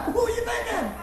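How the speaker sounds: high, laughing replay of the question; laughing mimic. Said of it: "I don't know what I was thinking".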